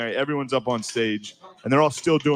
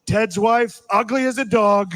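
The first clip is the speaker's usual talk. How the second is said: high, loud Trump voice; Trump impression; 9 semitones higher, 5 dB louder, slower than usual. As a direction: blurt it out like Trump. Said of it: "he's just like".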